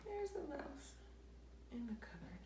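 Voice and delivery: ordinary delivery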